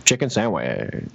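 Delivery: a coworker drawling his order, in a low slow put-on voice; low, slow mock old man voice. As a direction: drop low and drag out chicken sandwich. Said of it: "I mean".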